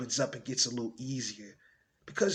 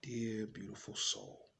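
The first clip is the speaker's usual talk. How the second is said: tender; 5 semitones lower, 18 dB quieter than usual. Acted. Monologue to an unborn child, tenderly. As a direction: speak almost in a whisper, leaving space between phrases.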